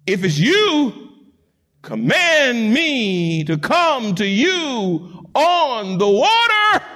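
very slow, stretched plea rising high at the end; calling out. Acted. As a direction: stretch each word as if calling across water. Acts Peter calling across the storm.

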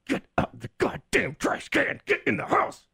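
high, tight angry dad yell; dad yelling through teeth. Retold or imitated. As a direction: bark it through clenched teeth. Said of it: "when they yell at you through their teeth".

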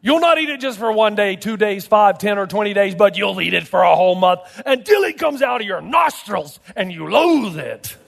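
escalating divine threat, hammered home; God pronouncing punishment; stern and emphatic. Acted. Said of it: "it's stern, it's emphatic kind".

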